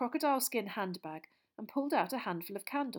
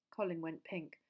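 ordinary delivery, nothing marked

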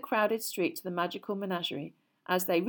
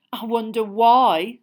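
louder, slow sarcastic; 3 semitones higher, 11 dB louder, slower than usual. Acted. Ron mocking Hermione's pity, slow and louder. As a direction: drip with sarcasm. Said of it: "said Ron sarcastically".

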